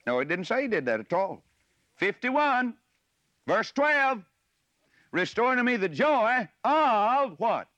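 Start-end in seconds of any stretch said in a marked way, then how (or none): 2.0-7.3 raised, slow call of "Restore to me the joy of"; proper emphasis. Shown as a demonstration. Preacher prompting listeners to finish the verse, emphatic. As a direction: stretch "joy" and "of" high, leaving it hanging. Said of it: "the, the proper emphasis".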